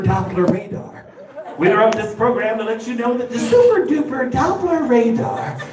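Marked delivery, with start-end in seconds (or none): 1.6-5.6 hyped news alert. Retold or imitated. Comic to the crowd, frantic newscaster voice. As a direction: ham up a frantic weather alert. Mock TV weather alert bulletin.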